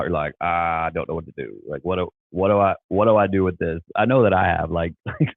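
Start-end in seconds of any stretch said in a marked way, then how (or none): none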